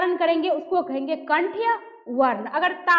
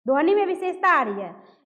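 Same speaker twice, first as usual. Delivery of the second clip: ordinary delivery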